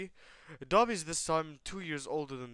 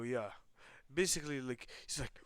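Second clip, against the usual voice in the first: ordinary talk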